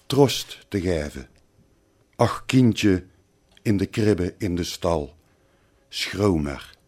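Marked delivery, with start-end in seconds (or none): 2.1-6.7 softer and lower, dying away to very quiet; hushed, falling close of a poem; fading consolation. Acted. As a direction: let the poem's last lines sink gently away. Poem's closing consolation to the child.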